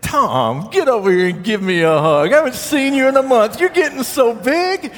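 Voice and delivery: high, fast gushing greeting; mock gushing welcome. Imagined coworker greeting the pastor, mock gushing and high. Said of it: "Roger didn't say".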